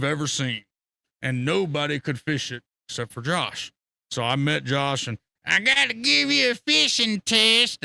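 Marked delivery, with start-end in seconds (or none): 5.4-7.8 high, loud, slow mock swagger; Josh's challenge. Josh tells the narrator he must pass a test, loud slow mock swagger. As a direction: announce it like a cocky gatekeeper. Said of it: "That's what he told me".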